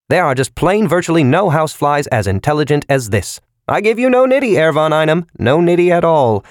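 high, loud, pushy pitchman; insistent salesman. A narrator's reading. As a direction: insist like a huckster. Bayron insisting to von Einem.

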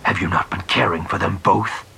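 angry. Acted. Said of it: "vocabulator buzzed with his rage".

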